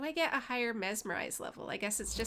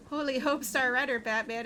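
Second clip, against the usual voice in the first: normal talking voice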